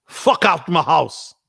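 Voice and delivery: put-on slow Jamaican-accented dismissal; imitated Jamaican dad. Jamaican dad throwing out his son's friends, imitated accent. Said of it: "he was a true Jamaican yardie".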